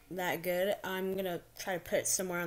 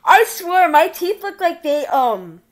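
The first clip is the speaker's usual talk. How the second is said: excited shriek; 8 semitones higher, 17 dB louder than usual. Real, not acted. Friends react on FaceTime, screaming.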